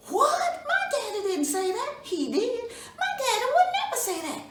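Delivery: a daughter who won't believe it; high surprised protest. Acted. Miss Nancy objects to Brer Rabbit, high and shocked.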